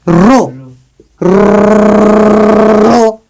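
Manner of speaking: "रो" alone, then with a very long rolled r; "रो" repeated with an exaggerated r roll; sounded-out syllable. Shown as a demonstration. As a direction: trill at length into the second "रो". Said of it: "देखो, तोड़ रहे हो".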